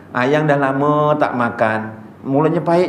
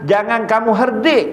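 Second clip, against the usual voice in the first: ordinary delivery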